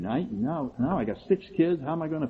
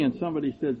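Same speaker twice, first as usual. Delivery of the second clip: ordinary delivery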